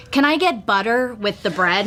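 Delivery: imitated American accent on "butter"; put-on American accent. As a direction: give in and order in an American accent. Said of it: "I'd always have to go".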